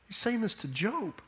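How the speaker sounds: normal talking voice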